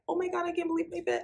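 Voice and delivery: high, fast, breathless delight; excited but not actually loud; retold thrilled squeal. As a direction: gush it out like the boots just zipped. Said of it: "I screamed so loud".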